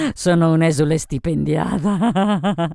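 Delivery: slow, loud words breaking into laughter; boozy self-mockery, laughing; laughing drunkenly. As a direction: draw it out into a laugh. Drunk Sophie laughs at herself.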